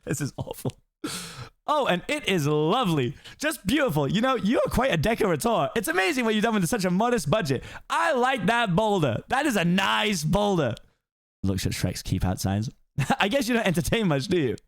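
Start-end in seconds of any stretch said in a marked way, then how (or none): 1.7-10.7 high fast-talking donkey voice; excited Donkey. Acted. Donkey flatters the swamp. 13.2-14.6 high fast-talking donkey voice; excited Donkey. Acted. Donkey teases Shrek.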